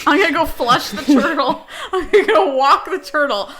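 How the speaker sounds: giggling, hammed-up bathroom announcement; mock announcing bathroom trip. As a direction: announce it through laughter like a joke. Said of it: "sounds like she's going to take a shit".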